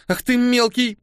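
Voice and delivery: angry. A narrator's reading. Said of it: "рассердилась Мия".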